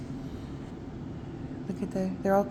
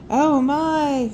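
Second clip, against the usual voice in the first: surprised; 7 semitones higher, 9 dB louder than usual. Acted. Santa surprised.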